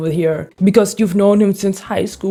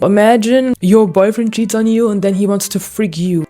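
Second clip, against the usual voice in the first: ordinary talk, nothing marked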